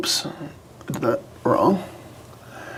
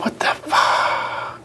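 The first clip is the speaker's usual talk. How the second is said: muttered whisper; 8 dB quieter, much slower than usual. Real, not acted. Chat not appearing.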